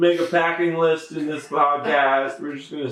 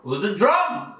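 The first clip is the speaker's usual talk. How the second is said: imitating Alan Watts; 7 semitones higher than usual.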